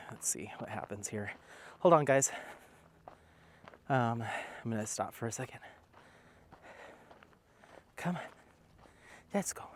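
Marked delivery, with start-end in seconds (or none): 0.1-1.3 whisper. Real, not acted. Sneaking up on dogs, whispering. 8.0-9.6 whisper. Real, not acted. Coaxing the dog quietly.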